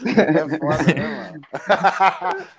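chuckling through the words; laughing. Real, not acted. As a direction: laugh while you say it. Laughing while answering.

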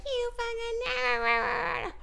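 put-on high, drawn-out shriek at the kids; stretched-out mock scream; mock yell. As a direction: scream it like a furious mom. Mom yelling at kids in pool.

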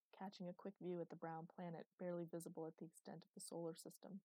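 plain reading voice

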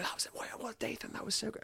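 whispered, high and faraway; breathy, distant, muffled praise; praise heard in a daze. Retold or imitated. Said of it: "everyone's going".

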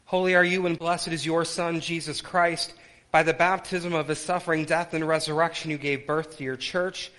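ordinary talk

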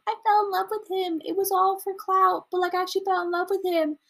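high, whiny mock confession; mock tearful Tana. Imitating Tana crying over Jake, high mock whine. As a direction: ham up a crying influencer. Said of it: "being like".

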